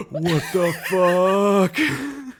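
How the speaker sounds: slow, high, loud stunned cry; shocked disbelief. Pretend. Fan in the theater reacting to a reveal: stunned outcry. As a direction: drag it out in total disbelief. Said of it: "I'd be like".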